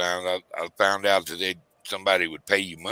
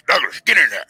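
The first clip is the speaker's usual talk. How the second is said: louder and higher mock fatherly order; dad ordering him in; 6 semitones higher, 8 dB louder than usual. Father ordering his son back into the room, loud imitated command. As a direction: call him back in like dad would, half-hammed. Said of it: "That's how he talk".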